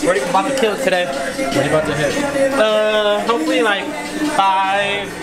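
ordinary talk, nothing marked

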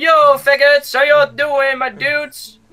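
loud greeting. Pretend. Loud mock greeting to strangers.

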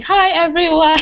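mock-cheery greeting to a video audience; chirpy greeting. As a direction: call out a high, bright hello. Re-enacts nervous first video greeting.

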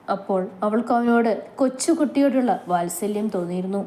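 plain reading voice

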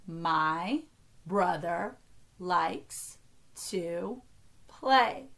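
one word at a time, long pauses between. Shown as a demonstration. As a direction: count it out slowly, separating each word. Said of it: "only count each word as one".

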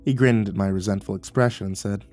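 usual speaking voice

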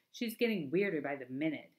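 plain reading voice

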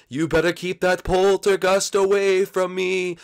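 high, slow ghost voice; nervous little ghost warning; wary ghost. Acted. Blimpy warns Luigi to keep the poltergeist away, high and slow.

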